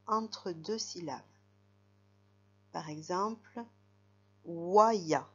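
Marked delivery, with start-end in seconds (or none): 4.4-5.3 "waya" loud and stretched over the glide; dictated syllables. Shown as a demonstration. Teacher dictating syllables to write, loud, drawn-out "waya". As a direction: project "waya", drawing out both syllables. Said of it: "je vais te dire des syllabes".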